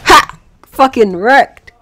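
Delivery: loud, drawn-out gloating; triumphant shout. Real, not acted. Player gloating as the enemy dies in his trap, loud. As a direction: crow over the kill, slow and loud.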